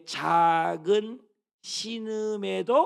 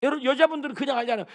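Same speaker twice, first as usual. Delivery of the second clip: usual speaking voice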